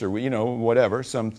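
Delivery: ordinary talk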